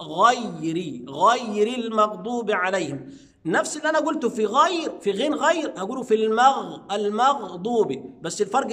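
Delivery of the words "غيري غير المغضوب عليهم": غير with heavy ghain, then light ra, said very slow. Shown as a demonstration. Teacher showing ghain then light ra. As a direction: drag غيري out. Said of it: "أنزل من المفخم إلى المرقق".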